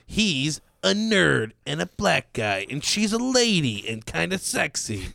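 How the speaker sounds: hammed-up movie trailer voice; trailer narrator voice.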